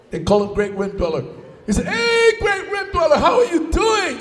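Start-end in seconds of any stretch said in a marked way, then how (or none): 1.9-4.2 hearty hailing. Acted. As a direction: call out cheerfully to a big fellow. Great Spirit hails the giant.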